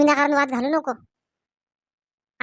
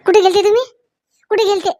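loud, angry and higher question; angry demand; 5 semitones higher, 6 dB louder than usual. Acted. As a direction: demand to know where he went. Wife confronting husband who lied. (रागाने जाब विचारणे)

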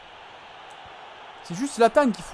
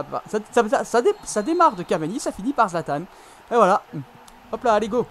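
normal talking voice

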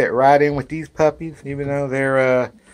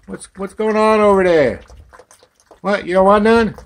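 high, loud questioning call; calling out to puppies; 8 semitones higher, 6 dB louder than usual. Real, not acted. Owner asking puppies what's going on, high loud call.

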